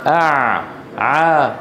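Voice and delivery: ordinary delivery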